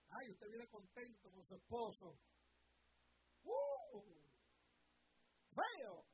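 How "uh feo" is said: high squealed scorn; mock disgust. Preacher mimicking family mocking a husband, mock disgust. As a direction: sneer it like a scornful relative.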